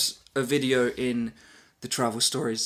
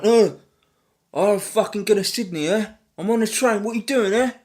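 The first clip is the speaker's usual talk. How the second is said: loud, high-pitched ranting Australian passenger, hammed up; mock Australian accent; 11 semitones higher, 8 dB louder than usual. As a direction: yell back in a badly faked Australian accent. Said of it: "Again, not a very good Australian accent".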